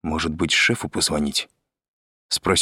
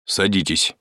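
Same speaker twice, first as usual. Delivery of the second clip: dry. A narrator's reading. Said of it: "сухо сказал Андрей".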